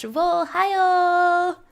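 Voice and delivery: slow, high, stretched call-out; excited name call. Real, not acted. Streamer greeting chatters by name, excited call. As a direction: sing out the names.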